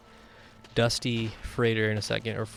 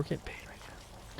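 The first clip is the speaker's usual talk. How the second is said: whispering; 5 semitones higher, 13 dB quieter, slower than usual. Real, not acted. Whispered joke aside.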